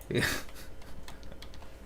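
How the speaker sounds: brief, soft breathy chuckle. Real, not acted. Streamer amused by his own question, chuckling softly. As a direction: let out a quiet little laugh. Amused while placing piece.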